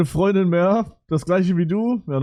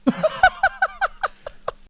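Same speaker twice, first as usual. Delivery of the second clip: high burst of laughter. Real, not acted. Reacts to clip.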